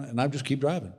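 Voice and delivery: ordinary talk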